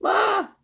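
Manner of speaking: high, loud cry of terror; scream. A narrator's reading. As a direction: scream out in fright. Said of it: "The screams continued".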